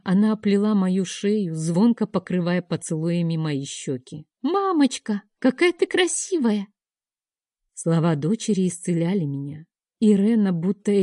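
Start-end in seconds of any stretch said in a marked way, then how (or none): 4.4-6.7 put-on high child's voice, delighted; little girl. A narrator's reading. Daughter hugs her mother, high happy child voice. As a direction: voice the small girl, high and eager.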